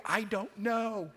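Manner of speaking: high, exasperated retort, acted out; worked-up mock protest, drawn out and rising high; agitated. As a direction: imitate an agitated dad, pitch climbing on the last words. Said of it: "I was just so agitated".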